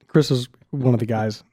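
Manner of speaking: normal talking voice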